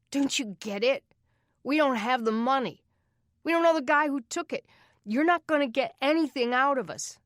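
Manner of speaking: high, loud desperate yell; a cornered kid yelling back; boy shouting at the man who offers help; angry boy shouting. Acted. Aaron shouting at Doug.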